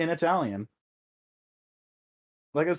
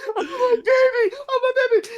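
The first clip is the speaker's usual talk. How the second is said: hammed-up Russell Crowe wail; 8 dB louder than usual. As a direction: wail it like a grieving dad, overdone. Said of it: "Russell Crowe's like".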